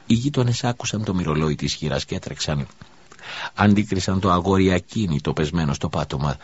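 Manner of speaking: ordinary delivery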